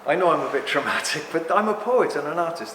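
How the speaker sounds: ordinary delivery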